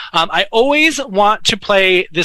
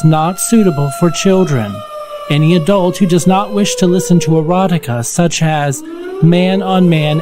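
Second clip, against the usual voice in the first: announcer read; 3 semitones lower than usual. Acted. Mock warning intro read.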